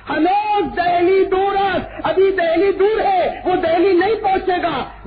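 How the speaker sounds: high, loud, slow pronouncement; thundering prophetic declaration; saint's proclamation. Acted. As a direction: proclaim the prophecy for all to hear. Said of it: "अपनी खानकाह में कहा".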